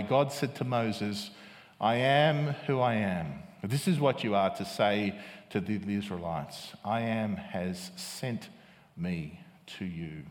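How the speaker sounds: low, hushed scripture reading. Acted. Reads scripture aloud to congregation.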